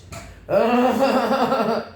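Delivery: real burst of loud laughter. Real, not acted. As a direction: laugh out loud at own joke. Loud laugh after joke.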